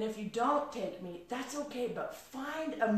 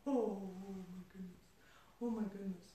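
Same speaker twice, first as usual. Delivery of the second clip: soft, stunned murmur; hushed amazement; 10 dB quieter than usual. Retold or imitated. Reacting to being hired as mentor, hushed awe. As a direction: breathe it out quietly, overwhelmed.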